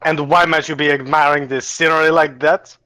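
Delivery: shaky attempt at German, sliding into French; botched German accent. Game master as alien to telepathic mushroom, botched German accent. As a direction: speak English with a bad German accent. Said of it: "It was supposed to be German".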